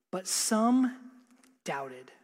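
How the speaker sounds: pause, then "doubted" dropped low and soft; emphasis on doubted. Real, not acted. Preacher reading that some disciples doubted, weighty low emphasis on "doubted". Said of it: "put an emphasis on the word doubted".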